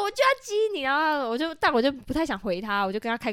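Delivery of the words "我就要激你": high-pitched teasing imitation; mock taunting. Man at club insisting on daring her, mock taunting. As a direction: needle them with a mock dare.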